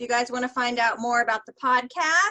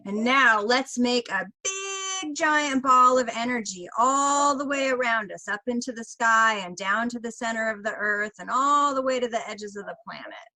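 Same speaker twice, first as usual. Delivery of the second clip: put-on stretched-out big words, coaxing a child; nanny guiding a child. Nanny helping a child expand energy, acted encouragement. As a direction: draw out the size words as if for a kid. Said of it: "And then I'll say".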